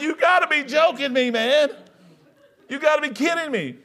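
can't believe it, cracking up; husband laughing in disbelief at tiny breakfast. Retold or imitated.

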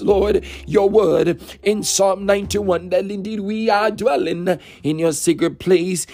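usual speaking voice

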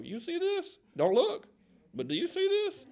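very high-pitched, incredulous mock aside; scandalized aside. Onlooker to his wife about naked Saul, scandalized aside. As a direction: squeak it confidentially to a spouse. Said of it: "cuts their eyes towards their wife".

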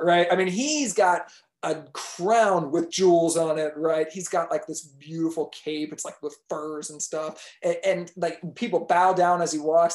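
ordinary delivery

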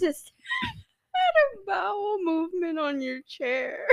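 giggling through the words; laughing confession. Real, not acted. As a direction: confess while cracking up.

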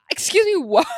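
high, loud incredulous outburst; mock disbelief. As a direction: burst out loud and high with a laugh. Acts out disbelief at fun fact.

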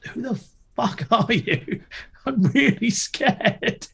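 high, slow mock fright; mock scared. Imitating himself scared of the big man, mock fright. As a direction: play it timid and spooked. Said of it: "I was like".

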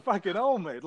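high-pitched mock exasperation; exasperated outburst. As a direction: throw it up high like you can't believe it. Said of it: "yeah, it's like".

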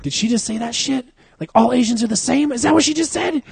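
high, incredulous mock gasp of disbelief; can't believe she said that; shocked disbelief. Coworkers retell shocked reaction.

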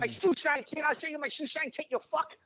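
high menacing Pesci taunt; Joe Pesci Goodfellas impression. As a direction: sneer it like a hothead gangster. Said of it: "bash the motherfucker in the head".

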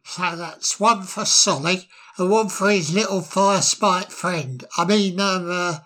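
put-on slurring, giggly drunk voice; tipsy bird, high and mocking; town drunk. Patoo drunkenly lists orders.

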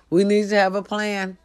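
ordinary delivery

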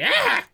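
a punched enemy yelps; pained yelp. Acted. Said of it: "Dax is like".

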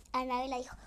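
ordinary delivery

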